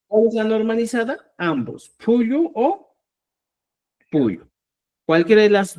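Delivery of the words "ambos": ordinary talk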